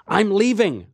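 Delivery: high, loud call of I'm leaving; shouting at a screaming stranger. Acted. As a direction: shout it while backing away. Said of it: "I shouted".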